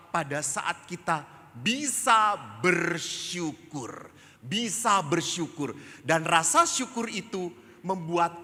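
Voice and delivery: high, loud preacher proclaiming; emphatic preaching. Acted. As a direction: drive the lesson home forcefully, stretching key words. Preaches the lesson at full force.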